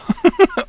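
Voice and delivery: very high squealing laugh; cartoon laugh. Real, not acted. Laughing at uvula joke.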